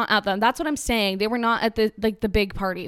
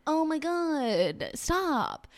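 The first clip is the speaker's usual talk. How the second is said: slow, higher, fed-up groan of words; exasperated; 7 semitones higher, much slower than usual. Retold or imitated. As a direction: beg them to stop, drawn out. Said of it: "it's like".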